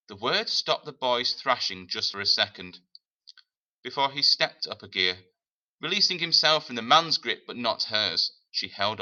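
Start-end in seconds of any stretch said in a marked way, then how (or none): none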